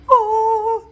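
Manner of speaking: high thrilled gasp; delighted gasp. Real, not acted. Gasps at her ephemera.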